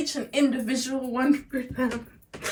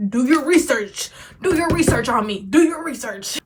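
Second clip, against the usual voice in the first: louder, punchy repeated retort; sassy emphatic; 2 semitones higher, 4 dB louder than usual. Retold or imitated. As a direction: hammer the line with attitude.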